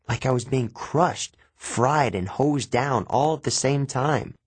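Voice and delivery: plain reading voice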